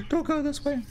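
high frightened plea; pleading, scared; scared horror game streamer. Real, not acted.

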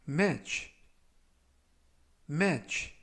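match said with a short open "meh" vowel; pronunciation demo. Shown as a demonstration. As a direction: model match for learners to repeat. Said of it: "라고 발음하시면 되겠고요". (발음 시범)

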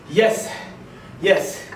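ordinary delivery

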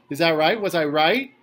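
put-on loud, high, beaming old man; proud excitement. A narrator's reading. Old man asking guests if he got it right, loud and proud. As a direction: crow for praise like a big kid.

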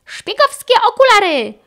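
high, loud puppy shout; loud, high gadget call; barked out. A narrator's reading. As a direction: bark it like an eager spy pup. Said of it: "szczeknął Chase".

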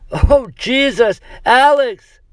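high, loud horrified cry; horrified wail. Acted. Scott sees his friend collapse, wailing in horror. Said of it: "Scott wailed in horror".

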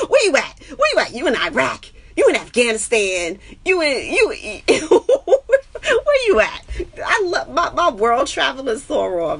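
high, loud teasing through laughter; laughing. Real, not acted. Streamer teasing her globe-trotting friend, laughing. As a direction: giggle out the questions.

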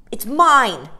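put-on loud, high possessive child; jealous boy. A narrator's reading. Michael claims the box jealously, loud and high. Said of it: "he said jealously".

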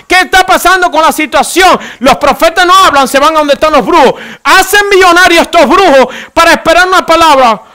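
impassioned, high-pitched sermon climax; full-force preaching. Real, not acted. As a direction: drive it up toward a shout. (prédica encendida a gritos)

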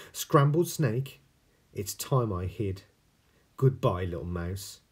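frightened snake. Acted.

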